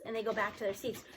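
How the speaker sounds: usual speaking voice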